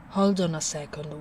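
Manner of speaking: usual speaking voice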